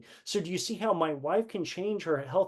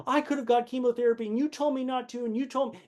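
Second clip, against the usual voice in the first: raised, higher pitched reproach from the wife; wife's accusation; 9 semitones higher than usual. Retold or imitated. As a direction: throw the blame back at him, pitched up. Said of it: "she's like".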